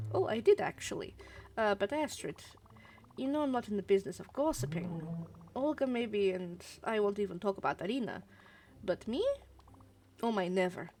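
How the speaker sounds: protesting gossip in a put-on Russian accent; animated amateur Russian accent, mock-innocent. Marsha denies being a gossip, indignant Russian accent. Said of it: "I'm doing my Russian accent for these".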